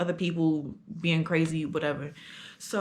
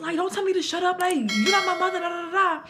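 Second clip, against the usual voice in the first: much higher mock angry comeback; student arguing back at the teacher, much higher; retold yelling back; 10 semitones higher than usual. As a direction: pitch it way up, furious and defiant. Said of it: "I started getting real loud".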